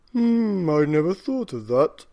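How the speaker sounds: a hint of a puzzled old husband; old man pondering. A narrator's reading. Old man answers wife, lightly voiced musing. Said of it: "said the very old man".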